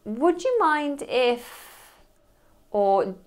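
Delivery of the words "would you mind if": "Would you mind if" drawn out slowly, higher; polite request. Shown as a demonstration. Asking someone for approval, slow and polite. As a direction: ask gently and slowly. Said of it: "you might say to someone".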